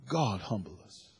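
softly spoken, prayerful plea; hushed prayer. Real, not acted. Pastor praying over the congregation, hushed. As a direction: breathe it out as a quiet prayer. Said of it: "prayerful repetition".

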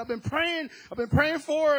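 high, loud retold plea of a frantic husband; desperate pleading. Same husband quoted again.

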